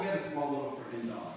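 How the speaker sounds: ordinary talk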